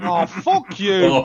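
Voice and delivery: loud, slow, drawn-out swearing; outraged reaction. Real, not acted. Player hearing the next film assigned, loud outrage. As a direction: curse the host loudly, stretching the words.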